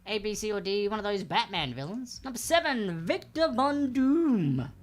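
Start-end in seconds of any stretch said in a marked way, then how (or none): 2.2-4.7 high, booming, stretched-out name; quiz host announcer. Acted. Trivia host reading question seven to players, announcing. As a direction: announce it with dramatic showmanship.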